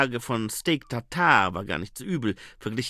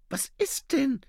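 very high female voice; Saccharissa; slower than usual. Acted. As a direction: pitch it up, answering the interruption. Woman answers the interruption. (sehr hohe Frauenstimme, nachfragend)

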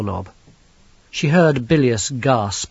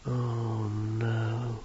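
barely breathed, much softer; hushed, horrified breath; 10 dB quieter than usual. Acted. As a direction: breathe it out in dread. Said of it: "she breathed".

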